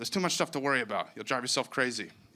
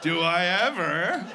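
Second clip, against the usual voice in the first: put-on flustered shop girl; 3 semitones higher, slower than usual. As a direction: swoon like a charmed clerk. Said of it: "They're like".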